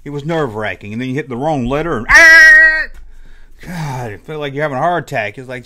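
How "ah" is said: sudden high loud shriek of panic; panicked shriek. Retold or imitated. Retelling toy-spelling panic to viewers, sudden shriek. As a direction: shriek like you just hit the wrong key. Panic at hitting the wrong letter.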